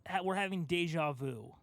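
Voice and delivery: usual speaking voice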